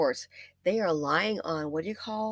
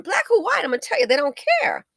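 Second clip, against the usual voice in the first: indignant; 14 semitones higher, 5 dB louder than usual. Real, not acted. Ranting about rich friends.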